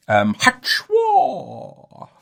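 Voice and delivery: high, drawn-out yelp "hachwa"; silly cry catchphrase. Pretend. Podcaster unveiling his newest catchphrase, a long high yelp. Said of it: "That's my latest one".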